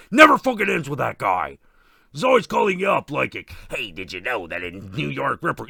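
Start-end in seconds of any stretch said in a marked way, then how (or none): 3.7-5.7 deep Ghostface voice. Retold or imitated. Ghostface pestering the slasher, low caller voice. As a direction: lower menacing caller reciting trivia.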